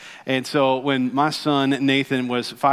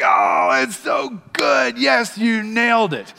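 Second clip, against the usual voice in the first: excited shout of praise; delighted praise; 4 semitones higher than usual. Retold or imitated. Father cheering his son's catechism answer, excited. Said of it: "And I was like".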